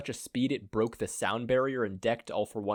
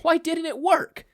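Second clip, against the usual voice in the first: much higher, shocked outburst; shocked exclamation; 4 dB louder than usual. Acted. Star exclaiming as she pries the hand off, high and shocked. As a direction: cry out in disbelief, high. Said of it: "Star exclaimed".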